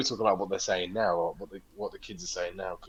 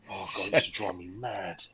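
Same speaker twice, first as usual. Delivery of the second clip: normal talking voice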